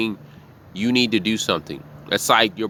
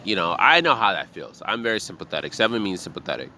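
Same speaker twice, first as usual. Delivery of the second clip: normal talking voice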